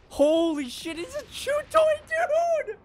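climbing into a very high squeal; amazed squeal. Real, not acted. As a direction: let astonishment push the pitch up. Amazed at the scene.

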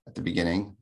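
ordinary talk, nothing marked

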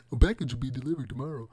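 lightly imitated automated announcement; phone announcement. Streamer mimics his phone's announcement, quick light impression. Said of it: "I love that announcement on my phone".

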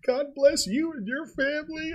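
high, sweet imitation of an old woman; grateful old lady. Guest retelling the widow's thanks, high and sweet. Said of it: "she's like".

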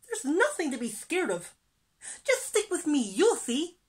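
high, loud, perky voice; reassuring little fish. A narrator's reading. Little fish leading scared friends, high and confident.

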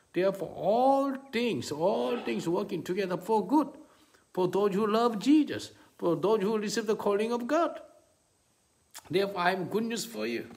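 loud, high preacher's proclamation; impassioned preaching. Real, not acted. Preacher declares good news to the congregation, impassioned preaching. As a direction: proclaim it to the whole congregation at full force.

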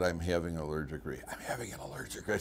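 very soft, fading to a whisper; voice going. Retold or imitated. Patient telling the emergency clerk of his reaction, failing whisper. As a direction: imitate trying to speak with almost no voice. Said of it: "my voice started going".

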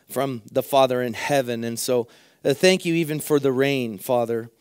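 usual speaking voice

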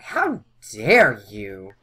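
loud, slow, drawn-out indignation; hammed-up fury; mock outrage. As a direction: act out an enraged character, stretching the words. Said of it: "Ooh, she's mad".

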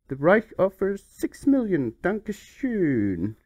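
slow, stiff mock German accent. Reading the German offer in a hammed-up German accent. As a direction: drag out Dankeschön like a German official.

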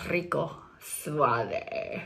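drawn-out, low, smooth introduction; Rico Suave. Acted. Trying on a mustache character, suave. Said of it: "Hello, I am Rico suave".

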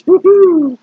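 high, loud gleeful whoop; mock crowd whoop. The banquet room cheers Haman's doom with a loud whoop.

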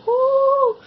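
high loud pained squeal; shrill pained ooh. Real, not acted. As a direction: yelp from the burning mouth. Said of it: "I can't even get through my outro".

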